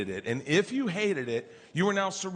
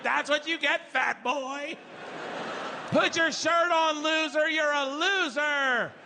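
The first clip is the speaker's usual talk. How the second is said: mocking yell; 10 semitones higher, slower than usual. Angry crowd jeers at the shirtless comic, yelling. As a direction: jeer it at the top of your lungs. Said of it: "mocking me at the top of their lungs".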